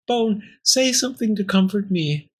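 high, needy little voice; pleading Pearl. Acted. Pearl hugging the bone for comfort, pleading. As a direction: appeal for comfort, pitched high.

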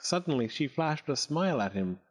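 ordinary delivery, nothing marked

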